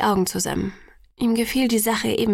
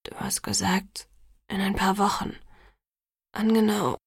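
whisper. A narrator's reading. Jacob whispers to Bella about the deadline, whispering. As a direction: barely whisper it, afraid of the answer. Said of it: "war seine Stimme nur ein Flüstern".

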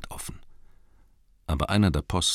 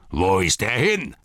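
orcs calling out; 3 semitones higher, 4 dB louder than usual. Acted. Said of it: "riefen sie".

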